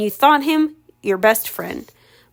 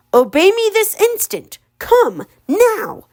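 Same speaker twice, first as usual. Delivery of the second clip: much higher, strange ogre command; 10 semitones higher, slower than usual. Acted. Ogre ordering Ella to come, commanding.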